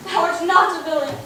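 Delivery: ordinary delivery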